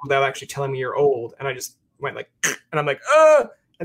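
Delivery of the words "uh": mock blank uh. Mimicking a blank reaction.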